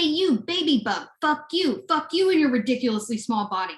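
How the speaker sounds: ordinary delivery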